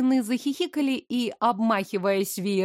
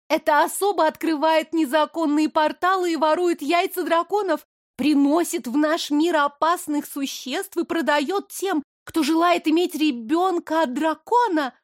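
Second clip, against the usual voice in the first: higher, lightly voiced accusing young lady; spiteful young blonde; 6 semitones higher than usual. Blonde accuses the innkeeper before the queen, higher spiteful voice. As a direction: tattle eagerly to the queen.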